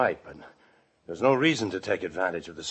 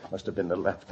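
ordinary delivery

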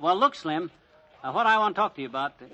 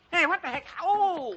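startled yelp; 11 semitones higher, slower than usual. Acted. Grabbed by the player.

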